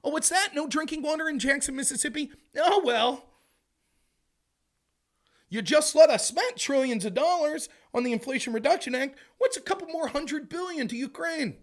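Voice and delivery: high, loud breezy disdain; mock dismissive official. Government brushing off taxpayers about Jackson water, dismissive. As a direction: shrug it off like a smug bureaucrat.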